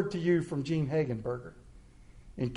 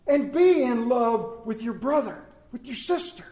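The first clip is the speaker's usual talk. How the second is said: raised, driving pulpit voice; emphatic preaching; 6 semitones higher, 4 dB louder than usual. Real, not acted. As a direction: press the message home to the congregation. Preaches his main point forcefully.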